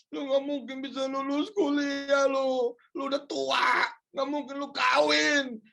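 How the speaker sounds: mocking taunts. Friends taunting a failing man, building mocking jeers. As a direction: jeer like a cruel neighbour, climbing in pitch. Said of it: "akan ngomong seperti ini".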